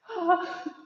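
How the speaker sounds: high laughing exhale; relieved laughing sigh. Real, not acted. Exhale after holding squat.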